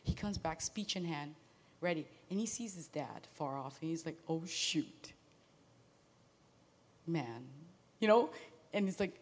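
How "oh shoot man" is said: low, soft, dismayed under the breath; a sinking "oh no" muttered to himself. Retold or imitated. Son spotting his dad in the distance, muttering in dismay. Son sees father far off.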